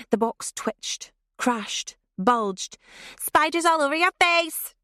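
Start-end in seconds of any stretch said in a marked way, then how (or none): 3.2-4.7 much higher and louder taunting; a cruel girl shouting at her trapped friend; gleeful yell. Acted. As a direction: yell it to make her panic more. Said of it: "Debs yelled".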